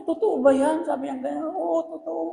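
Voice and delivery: a hint of a hurt wife; wife asking if the affair is true; upset wife. Wife reacts to confession.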